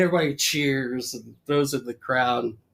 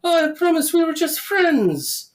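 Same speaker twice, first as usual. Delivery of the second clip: very high mock-female pleading; pleading lady; 4 dB louder than usual. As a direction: plead like a melodrama damsel. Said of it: "And she goes".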